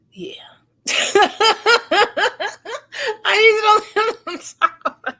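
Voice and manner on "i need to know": high, helpless laughing through the words; real laughter. Real, not acted. Telling viewers about her mixed-up partners, helpless laughter. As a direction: laugh while trying to talk.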